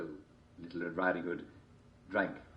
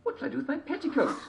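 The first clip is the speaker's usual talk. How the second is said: put-on girl voice; 3 dB louder than usual. A narrator's reading.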